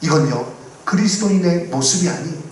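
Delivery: ordinary talk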